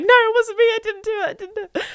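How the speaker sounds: high whimpering protest that fades out; mock panicked denial. Goody two shoes accused of something, whimpering high denial. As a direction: plead like a guilty child close to tears.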